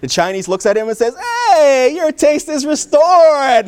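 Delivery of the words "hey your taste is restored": loud, high, drawn-out celebration; triumphant cheer. Acted. Doctor to furious patient he has just tricked, triumphant cheer. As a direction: crow it slowly like a big win.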